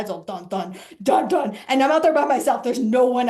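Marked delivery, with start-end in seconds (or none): 0.2-1.6 low dun dun, then louder; Jaws theme hum. Retold or imitated. Telling of fearing sharks, humming the Jaws theme. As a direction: mimic the shark music rising. You hear the Jaws music.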